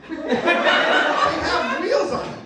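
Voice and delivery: high, slow, laughing through it; squeaky laughing repeat. Real, not acted. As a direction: break up laughing on the words.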